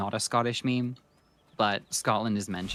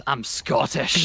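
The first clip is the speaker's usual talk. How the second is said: loud, drawn-out heroic declaration; Blue Ranger; 5 semitones higher, 8 dB louder, much slower than usual. Retold or imitated. Ranger after judo throwing the villainess, slow loud declaration. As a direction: announce it proudly, slow and loud. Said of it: "judo throws her and then goes".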